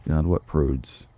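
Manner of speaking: normal talking voice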